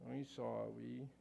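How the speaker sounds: lightly voiced sheepish boy, slow and low-key; sheepish apology. Boy apologizing to Susie with eyes down, sheepish. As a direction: draw out a reluctant, respectful little apology. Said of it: "He's being very respectful. He's keeping his eyes down".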